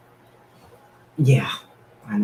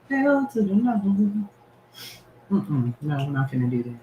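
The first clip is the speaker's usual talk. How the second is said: sassy refusal; 9 semitones higher than usual. Acted. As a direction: draw it out with full sass. Refusing cold weather.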